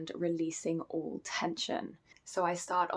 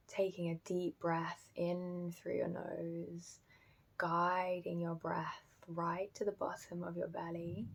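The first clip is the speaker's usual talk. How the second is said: guided meditation; 9 dB quieter than usual. Acted. Teacher guiding listeners into breathing practice, soft meditation voice. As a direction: ease them gently into stillness, softly and unhurriedly.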